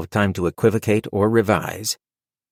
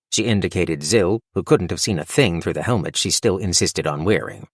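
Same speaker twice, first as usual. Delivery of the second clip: ordinary delivery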